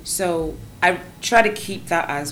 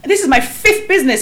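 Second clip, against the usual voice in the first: high, loud, laughing disbelief; laughing mock surprise; 13 semitones higher, 11 dB louder than usual. Entrepreneurs mocking one success story, high and laughing. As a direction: squeal it through a laugh.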